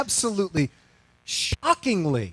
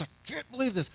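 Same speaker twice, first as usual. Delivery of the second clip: muttered disbelief; 7 semitones higher, 8 dB quieter, faster than usual. Retold or imitated.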